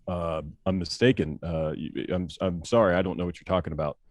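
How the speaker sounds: nervous denial. Retold or imitated. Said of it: "I was kinda getting nervous".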